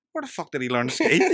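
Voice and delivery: acted incredulous outburst, high and loud; loud disbelief. Comedian reacting to son being ski instructor, loud disbelief. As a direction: blurt it out in mock astonishment.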